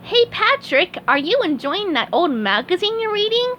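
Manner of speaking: very high squeaky cartoon sponge; chirpy high-pitched sponge, cheerful; SpongeBob voice. SpongeBob praising Doctor Patrick, high squeaky sponge voice.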